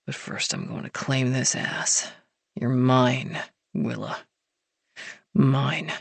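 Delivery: low whispered snarl. A narrator's reading. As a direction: snarl it into her ear. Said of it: "he snarled".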